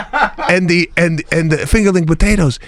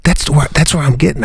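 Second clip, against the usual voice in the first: ordinary delivery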